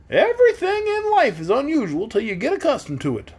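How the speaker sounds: squeaky and amused; Scarecrow voice. A narrator's reading.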